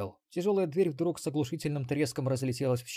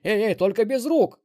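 loud, higher alarmed yell; shout; 9 semitones higher, 11 dB louder than usual. Acted. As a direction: yell it to stop him. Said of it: "заорал Ксенобайт".

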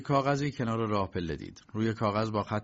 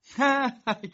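high, loud scornful laugh; mocking laugh; 6 dB louder than usual. A narrator's reading. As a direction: laugh him off. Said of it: "کی با تمسخر گفت".

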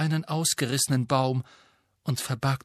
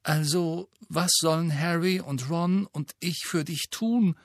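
anxious Hermine; 6 semitones higher than usual. Acted. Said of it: "fragte Hermine bang".

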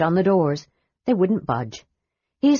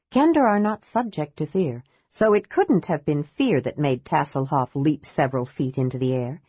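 ordinary delivery, nothing marked

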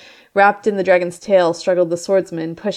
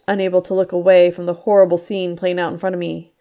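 ordinary delivery

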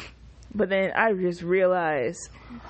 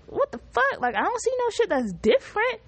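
shocked disbelief; 12 semitones higher than usual. Retold or imitated. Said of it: "I was like".

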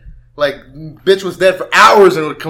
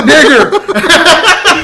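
much higher and louder, laughing; 13 semitones higher, 10 dB louder than usual. Retold or imitated. Retelling the slip to his friend, laughing hard. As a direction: cry it out between laughs. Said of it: "I said".

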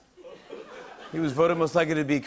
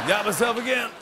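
laughing; 5 semitones higher than usual. Real, not acted.

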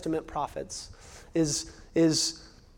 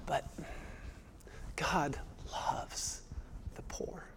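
whispered, breath only, intimate; 16 dB quieter, slower than usual. Acted. Preacher whispering to the congregation about the poor, whispered. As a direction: drop to a whisper to let it land.

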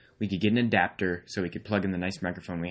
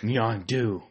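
put-on character name. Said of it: "do a voice".

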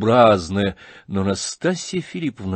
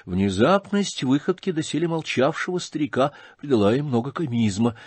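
ordinary delivery

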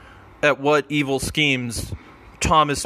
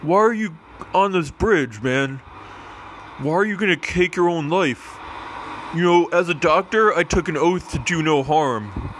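raised pitch, earnest doctor role; doctor character voice; 3 semitones higher than usual. Acted. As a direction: talk him down as a cheery doctor. Doctor character pleads on bridge.

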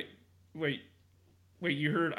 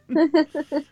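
high burst of laughter; 11 semitones higher, 8 dB louder than usual. Real, not acted.

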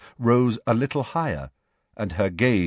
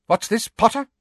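sharp; 13 semitones higher, 5 dB louder than usual. A narrator's reading. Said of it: "said Professor McGonagall sharply".

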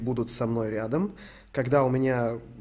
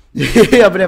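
laughing burst; 5 semitones higher, 14 dB louder than usual. Real, not acted.

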